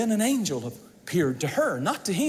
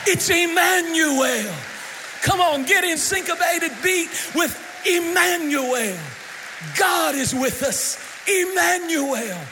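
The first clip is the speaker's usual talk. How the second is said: climactic preaching; 3 semitones higher than usual. Acted. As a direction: build to the climax of the sermon.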